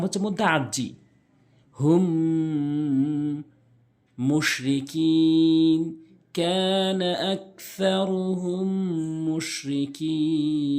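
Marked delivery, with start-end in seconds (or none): 1.8-10.7 slow chanted tajweed reading; Quran recitation. Shown as a demonstration. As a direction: recite slowly, stretching the madd. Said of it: "Quran recitation". (ধীরে টেনে টেনে তিলাওয়াত)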